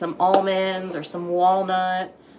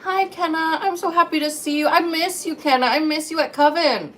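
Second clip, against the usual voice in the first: much higher, gushing happy voice; excited greeting; 9 semitones higher, 3 dB louder than usual. Real, not acted. Greeting returning viewer.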